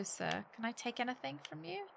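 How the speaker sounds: ordinary talk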